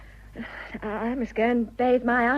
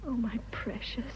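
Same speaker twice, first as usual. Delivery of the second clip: breathy whisper, far softer; whispered endearment; 8 dB quieter than usual. Acted. To Teddy, whispering her endearment. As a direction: breathe it to him tenderly. Tender reply to a love declaration.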